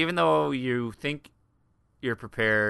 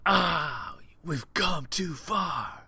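mock dramatic lament; 3 semitones higher, much slower than usual.

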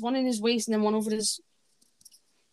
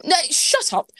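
shouting; 5 semitones higher, 7 dB louder, slower than usual. Real, not acted. Siblings arguing over wrestling.

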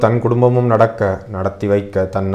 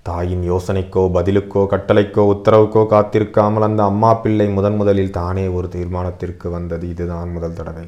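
ordinary delivery

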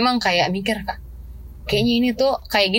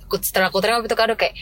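usual speaking voice

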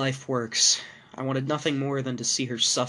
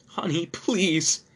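sobbing; 6 semitones higher, 3 dB louder, much slower than usual. A narrator's reading. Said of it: "my wife sobbed".

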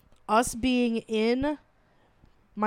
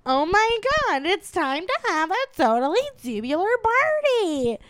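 put-on higher Valley Girl gush; Valley Girl accent; 8 semitones higher, 3 dB louder than usual. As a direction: greet friends like a Valley Girl. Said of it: "we'd go".

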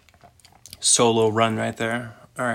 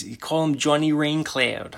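higher-pitched Italian mobster put-on; 4 semitones higher than usual. Mobster warning someone about Johnny Raincloud, mock Italian mobster accent. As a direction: do a hammed-up Italian mobster threat. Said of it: "an Italian mobster".

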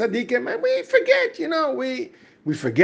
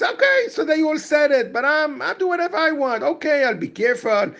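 higher-pitched mock self-talk; self-excusing inner voice; 8 semitones higher than usual. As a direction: lightly act out someone privately shrugging off a promise. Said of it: "So he thinks".

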